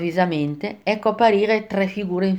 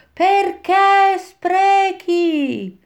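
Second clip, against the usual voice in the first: very high, long-drawn chanted cry; damned souls' retort; 12 semitones higher, much slower than usual. A narrator's reading. The other band of damned answering back, chanted retort. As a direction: stretch every vowel high, almost singing. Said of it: "E gli altri rispondevano".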